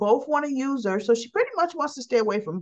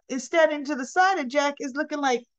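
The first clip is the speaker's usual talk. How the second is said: ordinary delivery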